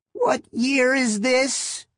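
put-on high, slow old woman's call; an old lady calling up, anxious; elderly voice calling. A narrator's reading. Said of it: "the elderly voice called back".

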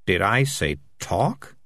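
slow, deliberate question; measured, menacingly patient; pointed rebuke. Acted. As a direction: space out the words as a warning. Morris rebuking the highwayman.

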